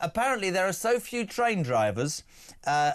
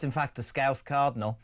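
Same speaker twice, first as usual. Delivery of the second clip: ordinary talk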